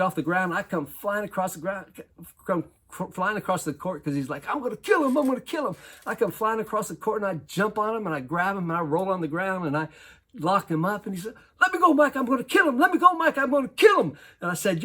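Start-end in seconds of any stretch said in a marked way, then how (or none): 4.5-5.8 higher, mock furious threat; enraged player threatening his teammate; imitating rage. 11.6-14.2 much higher, louder furious yelling; enraged player struggling in the coach's grip, yelling; imitating furious yelling.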